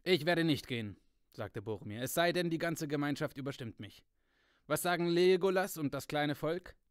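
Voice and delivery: Boromir, forceful. A narrator's reading. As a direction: press the warning hard and loud. Boromir voiced in read story.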